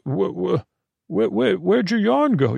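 high, sputtering stammer; stammering shock. Acted. A figure sputtering at the royal scout's vanished yarn, stammering high. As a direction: trip over the words in disbelief.

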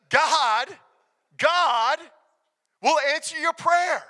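loud, high preaching declaration; emphatic proclamation. Real, not acted. Preacher rallies congregation about prayer, loud emphatic proclamation. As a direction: proclaim it forcefully, pausing after each GOD. Rallying congregation after weak amen.

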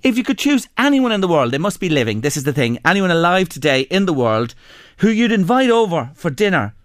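normal talking voice

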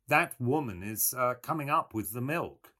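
plain reading voice

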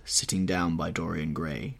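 ordinary delivery, nothing marked

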